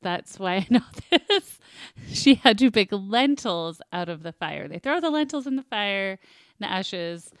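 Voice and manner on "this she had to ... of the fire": ordinary talk, nothing marked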